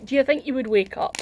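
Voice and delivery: plain reading voice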